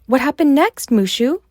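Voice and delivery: put-on eager girl. Mulan eager for the ending.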